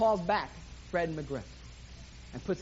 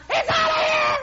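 excited announcer, much higher and louder, building; live play-by-play; 6 dB louder than usual. Acted. Preacher calling Ray's home run for the congregation, rising excitement. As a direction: call the homer like a radio announcer.

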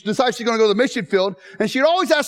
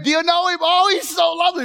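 higher, beaming question through laughter; elderly missionary beaming at the chapel crowd; joyful missionary; 8 semitones higher than usual. Retold or imitated. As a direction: ask it smiling, overflowing with joy. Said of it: "she asked it again".

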